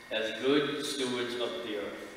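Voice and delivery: ordinary delivery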